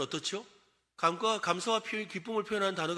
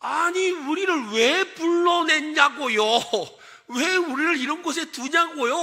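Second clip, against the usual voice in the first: loud, higher, drawn-out acted grievance; hammed-up resentful complaint, voice pushed up; resentful protest; 8 semitones higher, 6 dB louder, slower than usual. Israelites blaming God for calling them out, resentful protest. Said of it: "하고 원망했어요".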